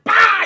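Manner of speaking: sudden high, loud "bah"; shouted impact noise. Retold or imitated. Streamer acting out the punch at the door, shouted impact. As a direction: burst out with the sound of the blow.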